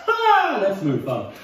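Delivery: laughing. Real, not acted. Laughing at own weird yell.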